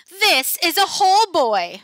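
high, loud smirking teacher voice; smirking monster teacher. A narrator's reading. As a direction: smirk through the words like a hungry monster. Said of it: "she smirks".

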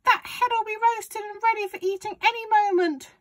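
very high, loud granny holler; shouting grandma. A narrator's reading. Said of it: "Grandma shouted".

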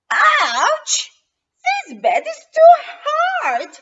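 high loud scream of pain. Acted. Goldilocks yells at the hard bed, loud. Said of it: "she screams".

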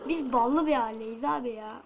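ordinary delivery

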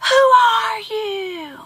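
slow, high call, softened by distance; faraway drawn-out holler; echoed question. A narrator's reading. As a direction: imitate the echo of a shouted question. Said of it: "And he hears".

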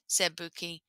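ordinary delivery

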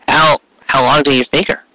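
usual speaking voice

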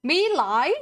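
"Melee" as MAY-lie, loud and rising; MAY-lie, questioning. Shown as a demonstration. Streamer repeating the played pronunciation, "Melee" as MAY-lie, surprised. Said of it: "it's completely different".

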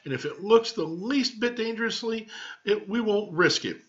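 high, fretting mother's voice; anxious mother. Acted. Mama insists on protecting Marvin, high and fretful. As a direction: fuss over him like a worried mom.

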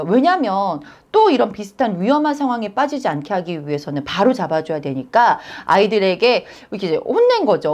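usual speaking voice

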